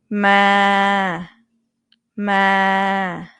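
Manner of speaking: "Ma" held level at mid pitch; flat mid tone "Ma"; Thai mid tone. Shown as a demonstration. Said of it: "Middle tone".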